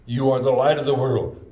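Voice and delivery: ringing sermon declaration; preaching. Acted. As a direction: proclaim it to the room. Preaching outburst.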